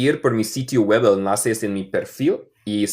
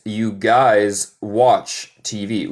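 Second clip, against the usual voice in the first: slow model sentence; much slower than usual. Shown as a demonstration. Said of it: "teacher models slow sentence".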